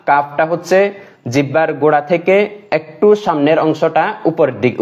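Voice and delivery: ordinary talk, nothing marked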